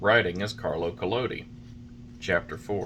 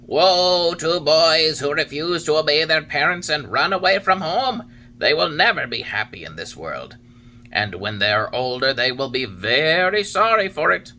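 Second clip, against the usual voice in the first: higher, louder solemn cricket warning; Talking Cricket warning; 7 semitones higher, 6 dB louder than usual. A narrator's reading. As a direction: preach it like a wise old insect.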